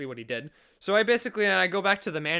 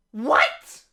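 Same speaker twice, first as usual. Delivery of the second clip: loud, higher-pitched disbelief; incredulous reaction; 6 semitones higher, 8 dB louder than usual. Retold or imitated. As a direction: blurt it out in stunned disbelief. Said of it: "my response is just literally".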